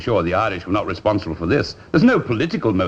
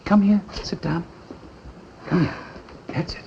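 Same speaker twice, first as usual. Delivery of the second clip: reassuring; 10 semitones higher than usual. Acted. Soothing a frightened maid.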